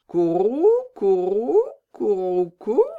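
a cooing bird call, rising; pigeon coo. Acted.

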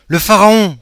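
high, loud cry of joy; bursting in with great news; excited shout. Acted. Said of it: "s'écria-t-il". (Emmanuel crie la nouvelle, fou de joie)

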